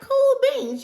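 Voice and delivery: high bright cool beans; excited exclamation. Retold or imitated. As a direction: squeal it out. Retold excited reply.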